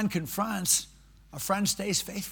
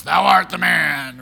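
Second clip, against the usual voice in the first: louder slow grand proclamation; booming prophet; 12 dB louder, slower than usual. Retold or imitated. Imagined Nathan thundering at David like Darth Vader. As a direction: boom it like a movie villain. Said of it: "looks like Darth Vader".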